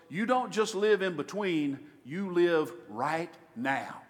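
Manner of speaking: ordinary talk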